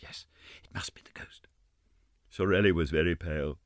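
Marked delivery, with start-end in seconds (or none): 0.0-1.5 panicked whisper. Acted. Frightened companions whisper among themselves. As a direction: murmur it under your breath. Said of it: "repeated under their breaths".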